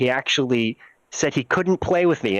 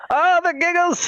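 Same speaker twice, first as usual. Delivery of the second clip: squeaky, higher and louder exclamation; mock giggle voice; 3 dB louder than usual. Laughing at his own giggles, high and louder. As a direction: hit the oh up high and loud.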